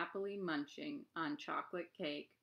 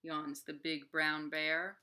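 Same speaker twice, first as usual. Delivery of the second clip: ordinary delivery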